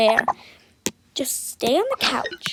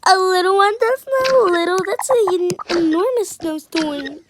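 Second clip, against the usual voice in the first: much louder and higher, outraged; indignant yell; 5 semitones higher, 7 dB louder than usual. Acted. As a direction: shout back in protest. Kid protests mom's reassurance.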